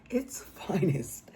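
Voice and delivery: slow, breathless whisper; sarcastic whisper. Real, not acted. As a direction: drop to a hushed, sarcastic whisper. Whispered repeat of the phrase.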